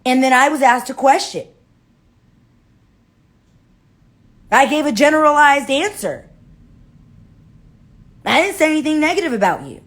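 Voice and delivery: high, loud protest; indignant self-defense. Acted. Susie defends herself to Mary Ellen, loud and indignant.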